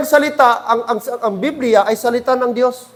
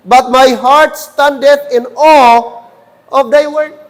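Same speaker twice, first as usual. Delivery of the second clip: scripture reading; 4 dB louder, slower than usual. Acted. Preacher reading scripture verse aloud.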